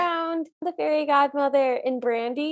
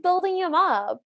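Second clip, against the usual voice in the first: ordinary talk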